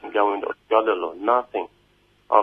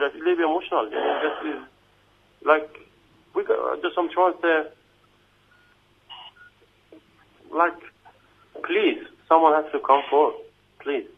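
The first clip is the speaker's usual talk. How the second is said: upset, pleading; 5 semitones higher than usual. Real, not acted. Detainee begging listeners for someone to come forward, emotional and raised. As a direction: voice lifting higher with real emotion. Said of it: "I'm sorry, I put emotion".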